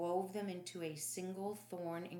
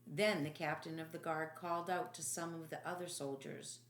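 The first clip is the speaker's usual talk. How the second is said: usual speaking voice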